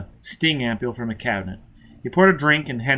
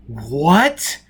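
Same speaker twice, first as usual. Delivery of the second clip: louder, stunned what; shocked cry; 2 semitones higher, 5 dB louder than usual. A narrator's reading. Foyle hearing of the refugees, shocked cry. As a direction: react in disbelief. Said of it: "Foyle cried".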